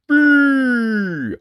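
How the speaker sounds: long, high, booming holler; loud giant's call. A narrator's reading. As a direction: bellow it out and hold the vowel. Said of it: "There came a loud".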